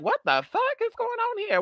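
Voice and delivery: high mock-shocked parent; a parent's outraged squeal. Vlogger imitates Jeffrey Dahmer's parents, high shocked squeal. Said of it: "the parents was like".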